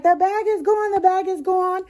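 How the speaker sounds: high, mock-alarmed chorus; lightly voiced frantic viewers. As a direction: imitate the viewers complaining. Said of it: "Everyone was like".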